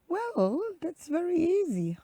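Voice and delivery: high, sing-song fox voice; self-assured storybook fox, pitched high; confident fox. Acted. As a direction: toss it off in a high lilting voice. Fox boasts it is easy.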